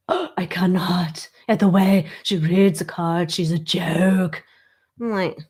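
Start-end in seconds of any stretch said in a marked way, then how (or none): 0.1-4.4 gasping, scandalised mock whisper; catty critic whispering, mock-appalled; snobby whisper. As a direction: gossip behind her back in a hush.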